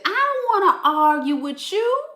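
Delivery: high teasing sing-song, laughing through it; mocking sing-song quote. To the viewer, mocking the Scorpio with a high laughing taunt. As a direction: mimic them in a high, taunting lilt. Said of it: "And you said".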